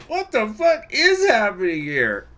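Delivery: high, slow, stunned disbelief; a man who can't believe what he sees; bewildered man. Retold or imitated. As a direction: voice a baffled man gaping at chaos. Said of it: "a face of a man who's going".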